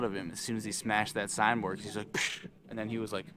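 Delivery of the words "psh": short hissed crash sound; voiceless burst for something shattering; imitated smash noise. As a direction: make a quick "psh" of impact.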